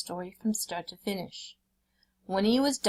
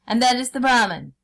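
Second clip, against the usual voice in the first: forceful tiger; 5 semitones higher, 8 dB louder than usual. A narrator's reading.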